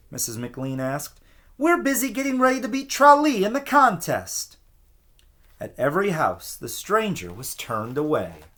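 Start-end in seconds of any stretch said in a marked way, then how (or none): 1.6-4.6 high, louder, annoyed housewife voice; annoyed woman. A narrator's reading. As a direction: snap at the stranger, high and irritated. Missus McLean annoyed.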